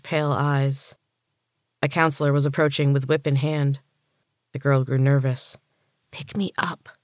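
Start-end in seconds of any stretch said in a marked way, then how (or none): 6.1-6.9 hushed, urgent demand; hissed. A narrator's reading. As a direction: hiss it under your breath. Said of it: "she hissed".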